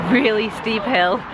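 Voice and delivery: normal talking voice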